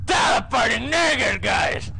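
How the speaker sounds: startled gamer shout; panicked shout. Real, not acted. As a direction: yell out in real alarm. Panicked yelling at game monsters.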